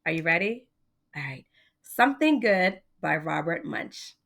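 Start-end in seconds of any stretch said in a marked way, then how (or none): 1.8-4.1 put-on lively read-aloud for children; story reading. A narrator's reading. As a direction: read it like a bedtime picture book. Reading a picture book aloud to children.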